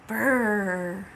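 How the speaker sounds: shivering brr from the cold. Acted. Cold night in the woods.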